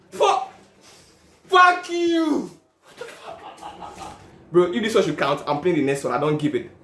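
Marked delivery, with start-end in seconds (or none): 0.1-2.5 high, loud cursing at the loss; angry outburst. Real, not acted. Gambler cursing the game after a loss, angry shout. Bet just lost. 2.8-4.2 breathless whispered laughing disbelief. Real, not acted. Gambler laughing in disbelief at losing, whispered.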